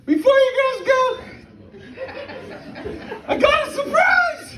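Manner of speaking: put-on high squealing girl announcing a surprise; excited girl voice. Bride teasing her girlfriends at dinner, high giddy voice. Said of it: "she's like".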